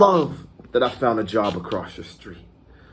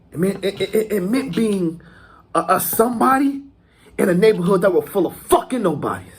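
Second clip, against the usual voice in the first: raised pitch, insistent and stammering; fervent boast; 7 semitones higher, 3 dB louder than usual. Acted. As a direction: press the point with growing fervour. Acted gangster monologue, fervent boast.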